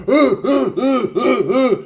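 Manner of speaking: high mocking uh-uh grunts in his face; taunting grunts.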